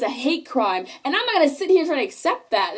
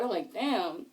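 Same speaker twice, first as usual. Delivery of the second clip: under-breath damn; 7 semitones lower, 11 dB quieter, slower than usual. Retold or imitated. Quiet muttered reaction.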